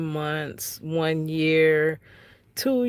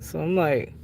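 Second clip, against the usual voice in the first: ordinary delivery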